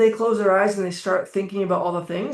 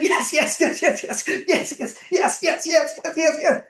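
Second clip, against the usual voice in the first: high, breathless yes chant with a laugh; excited cheering; 8 semitones higher than usual. Shown as a demonstration. Coach demonstrating excitement for listeners, cheering wildly. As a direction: whoop like you just won. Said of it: "get excited".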